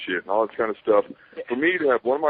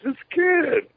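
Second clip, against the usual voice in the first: mock Kid voice pitched way up; high kid voice. Imitating Kid on the phone, voice pitched way up. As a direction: squeak it like a young kid on the phone.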